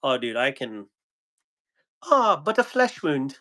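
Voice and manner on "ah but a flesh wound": lightly voiced plucky knight, high; Monty Python knight quote. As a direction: brush it off like the wounded knight. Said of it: "Monty Python".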